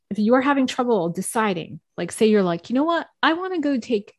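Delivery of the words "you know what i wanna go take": high, eager burst; excited decision. Retold or imitated. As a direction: sound suddenly sure of yourself. Voicing a sudden decision.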